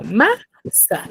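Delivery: "masa" split slowly, with "ma" held and rising; stress on MA. Shown as a demonstration. Teacher shows students the stressed syllable, stress on "ma" in "masa". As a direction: lean on the "ma" of "masa", then let "sa" follow apart. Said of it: "qué sílaba se pronuncia con mayor énfasis".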